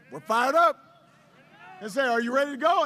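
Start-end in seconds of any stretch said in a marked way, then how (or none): 0.1-0.8 rousing response. Retold or imitated. Staff answer the candidate's call, rousing response. 2.1-2.8 loud rousing question; rallying call. Retold or imitated. Candidate rallies his staff, rallying call. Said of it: "this became a, a chant".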